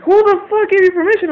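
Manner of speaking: high, loud outraged yell; furious dad. Retold or imitated. Dad yelling at his daughter over her tattoo, furious high shout. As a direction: blast it like a father who's lost it.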